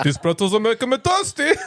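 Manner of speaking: goofy put-on voice with laughter; hammed-up silly voice; copied character voice. Shown as a demonstration. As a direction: carry the dopey voice into the line.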